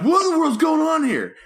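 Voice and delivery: very high, incredulous yelp; exasperated outburst. Retold or imitated. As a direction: act out a bewildered bedtime protest. Retells exasperated bedtime outburst.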